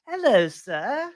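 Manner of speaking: high, very slow English accent; a lightly put-on posh English greeting; high-pitched English accent. Shown as a demonstration. Co-host tries the English accent the loser had to use. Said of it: "use a high-pitched English accent".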